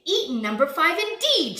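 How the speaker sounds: loud, high outburst; indignant shout. A narrator's reading. Big Bad Wolf replying to the ducklings' accusation, indignant shouting. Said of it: "shouted Big Bad Wolf".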